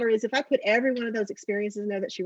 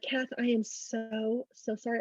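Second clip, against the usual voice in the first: soft, slow apology; 6 dB quieter, slower than usual. Real, not acted. Apologizing to her crying cousin.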